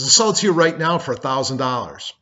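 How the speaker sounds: venting angry brother, loud; angry outburst. Retold or imitated. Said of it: "he's furious".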